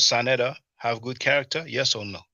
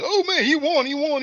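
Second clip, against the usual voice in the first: high, loud mock cheer; mock cheering crowd; 5 dB louder than usual. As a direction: ham up the fans crowing he won. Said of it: "they're like".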